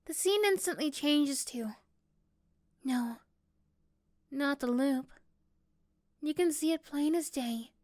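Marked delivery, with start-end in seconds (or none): none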